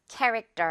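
캐릭터 with a tense, unaspirated 떨 ending; 캐릭터 front-stressed for learners to copy; model for repeating. Shown as a demonstration. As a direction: model 캐릭터 clearly, T as a tight 떨. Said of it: "듣고 바로 따라해주세요".

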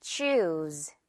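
"Choose" said low with a long oo and buzzed z. Shown as a demonstration. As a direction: Choose with the "oo" drawn out, pitched low. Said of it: "우 발음 길게 끌어주면 돼요".

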